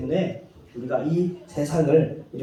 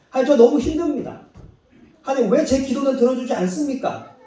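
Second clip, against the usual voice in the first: complaining to God; 6 semitones higher than usual. Retold or imitated. Believer complaining to God about hardships, whiny and fast. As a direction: rattle off grievances at God. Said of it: "라고 할 때".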